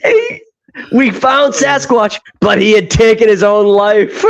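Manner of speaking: high, cracking with laughter, slow on "we found Sasquatch"; barely gets the line out for giggling; laughing through quote. Retold or imitated. Retelling the movie's fake-suicide line to friends, laughing throughout. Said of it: "And they're like".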